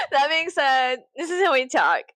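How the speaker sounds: amused, exaggerated "this is how we talk". Shown as a demonstration. Repeats the line laughing, exaggerated.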